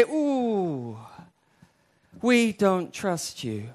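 drawn-out doubtful ooh, then slow refusal; acted reluctance. Israelites answer God at the promised land, slowly reluctant. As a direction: balk slowly, word by word.

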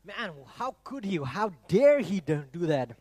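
hammed-up outrage, stretched out for effect; mock outrage. Preacher indignant at King Belshazzar, mock outrage. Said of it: "it makes you just furious and angry".

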